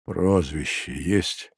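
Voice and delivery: slow, low, soft questioning; weary procurator. Acted. Procurator interrogating the prisoner, dull and slow. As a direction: ask it slowly in a sick, subdued voice.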